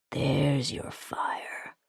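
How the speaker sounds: slow, hushed tease; faint whisper. A narrator's reading. Wounded prisoner teasing her temper, slow whisper. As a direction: whisper it with a weak smile.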